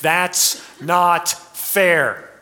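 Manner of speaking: put-on drawn-out, slow childish whine; slow sing-song child's complaint; whiny child. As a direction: sing it like a sulking kid. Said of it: "every child everywhere loves to sing".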